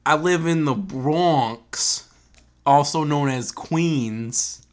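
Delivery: slow, badly faked New York accent, drawn out; clumsy mock New York voice. Host stays in character, hamming a bad New York accent. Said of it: "Bad. So bad".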